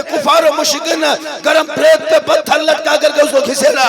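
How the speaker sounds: forceful, driving harangue at full voice; fiery preaching. Real, not acted. Preacher rebuking fathers who say Islam is in danger, fiery preaching. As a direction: preach hard, hammering each charge home.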